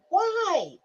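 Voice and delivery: high, tearful why; the word escapes on the edge of tears; choked up. Real, not acted. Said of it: "trying my best not to cry".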